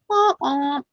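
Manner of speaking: playful "wah, wah" sound effect; mock letdown noise. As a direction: imitate a comic letdown sting. Imitated letdown noise after price.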